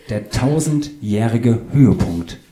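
slow, spaced-out tausendjährige Höhepunkt; patient repetition. Acted. Author repeats title for puzzled Claudius, slow and distinct. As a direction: spell it out slowly, word by word.

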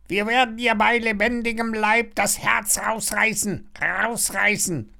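high, gleeful parrot threat; high-pitched bloodthirsty bird; croaking parrot. A narrator's reading. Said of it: "krächzt der Papagei".

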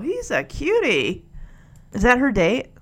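ordinary talk

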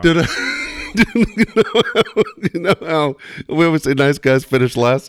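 talking through real laughter; laughing. Real, not acted. Still laughing as he starts telling the cohost a story. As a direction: let the laugh spill into the words.

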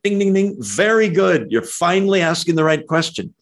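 high, playful quiz-host congratulation; bell-ringing approval. Acted. Preacher imagining Jesus rewarding the right question, playful approval. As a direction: ring them in as finally getting it. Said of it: "would have rang the bell and went".

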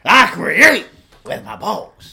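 slow, loud Pacino boast; Al Pacino impression. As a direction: drawl it like Pacino. Pacino persona.

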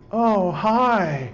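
high, spaced-out mock stoner greeting; stoned woman. Stoned woman answers the officer, spaced-out mock hi. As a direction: answer dreamily like a happy stoner. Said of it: "And the woman goes".

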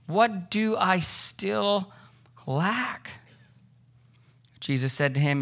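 unhurried scripture reading to the congregation. Acted. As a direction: read the gospel passage with reverence. Reads the gospel passage aloud.

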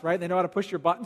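ordinary talk

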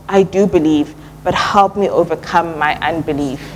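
normal talking voice

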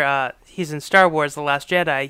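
ordinary talk, nothing marked

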